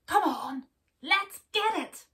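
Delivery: loud, rising battle call; rally the others to charge; rallying cry. Acted. The animals rallying to charge the monster.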